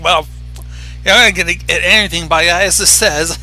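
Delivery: normal talking voice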